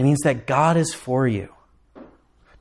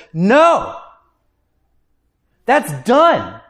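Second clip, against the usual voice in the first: Jesus declaring; 6 semitones higher than usual. Retold or imitated. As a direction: proclaim it before the throne, victorious. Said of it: "Jesus Christ stands there and says".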